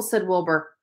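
ordinary delivery, nothing marked

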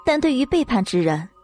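ordinary delivery, nothing marked

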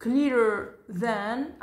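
slow teacher demo; slow model reading. Shown as a demonstration. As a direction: stretch it for learners to copy. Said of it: "إذن clear".

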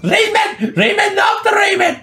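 very high, squealing imitation; mock frenzied cry. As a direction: squeal it as a hammed-up hype cry. Imitating the hyped shouting from video. (taklit ederek, incecik sesle çığırma)

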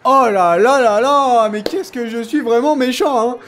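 high, loud, drawn-out wail; mock remorse. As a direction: lament it with hammed-up pity. Mock pity while beating the opponent.

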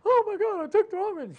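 high, loud panicked cry. Retold or imitated. Wife at the kitchen table panicking over the wrong medicine. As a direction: cry out as if the dose could kill you.

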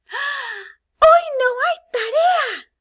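gasp, then very high, loud joyful squeal; excited exclamation. Acted. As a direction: act a child joyfully surprised, shouting the news. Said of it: "Vas a estar emocionado y vas a exclamarlo". (grito de alegría y sorpresa)

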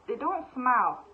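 ordinary talk, nothing marked

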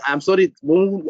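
ordinary talk

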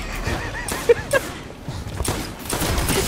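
long, high giggling laugh; real high laughing fit; high-pitched laughter. Real, not acted. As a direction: crack up in a high, drawn-out laugh. Laughs at own question.

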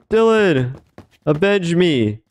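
high, hammy dying plea; overacted death scene. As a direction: cry out like a fallen hero. Dies in game, dramatic plea.

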